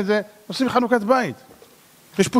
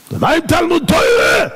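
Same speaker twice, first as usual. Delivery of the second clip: high, loud roar of a stern rabbi; roared question; 10 semitones higher, 5 dB louder than usual. Acted. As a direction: roar the question at the startled driver. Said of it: "נתן לו שאגה". (שאגה רמה של רב תקיף)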